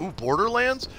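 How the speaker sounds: ordinary talk, nothing marked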